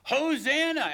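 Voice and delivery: high, loud jubilant shout; crowd shouting hosanna. Acted. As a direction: cry it out like a cheering crowd. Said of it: "and they're shouting out".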